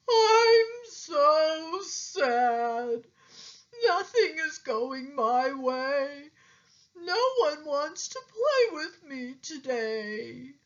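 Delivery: high, slow whimpering alligator voice; sad alligator. A narrator's reading. Alligator telling Pete nobody plays with him, high and weepy. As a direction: whine like you're about to cry.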